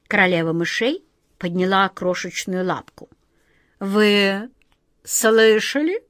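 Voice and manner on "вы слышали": slow, stretched question lifting at the end; commanding, drawn-out regal voice; imperious queen. A narrator's reading. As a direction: demand it with royal authority. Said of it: "властно произнесла она".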